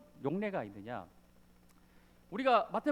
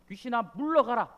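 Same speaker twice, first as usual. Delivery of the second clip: loud commanding exorcism cry; stern rebuke; 3 semitones higher, 7 dB louder than usual. Acted. Jesus rebuking the demon, loud stern command. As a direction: order the demon out with force. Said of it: "그 귀신을 꾸짖다".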